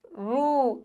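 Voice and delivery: रू with a long held oo; long drawn vowel. Shown as a demonstration. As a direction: let the oo of रू stretch. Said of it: "खींच रहे हैं थोड़ी मात्रा को". (रू को खींचकर बोलें)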